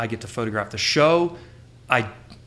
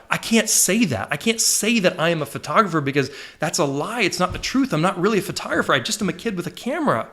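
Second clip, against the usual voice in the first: higher-pitched, acted self-doubt; panicked protest; 6 semitones higher than usual. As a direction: plead that you really can't, retelling your panic. Retells his panicked protest on phone.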